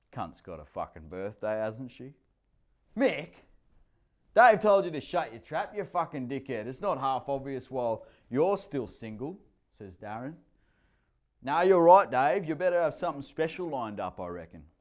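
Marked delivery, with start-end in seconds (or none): 3.0-9.4 loud larrikin mate voice, higher; a loud cheeky bloke ribbing his mate; mate's rough jibe. A narrator's reading. Mate mocking in ocker voice. 11.4-14.7 loud, high larrikin ribbing; jeering mate. A narrator's reading. Another mate jeers.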